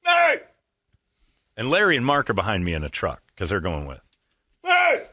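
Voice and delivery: calling out from the next car; retold yelling. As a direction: shout to get attention. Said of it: "he starts yelling".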